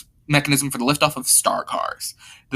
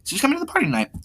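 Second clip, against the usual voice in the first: very high-pitched put-on girl's voice; high, light character voice for a girl; 8 semitones higher than usual. Laura explains, acted girl voice.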